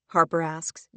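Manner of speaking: plain reading voice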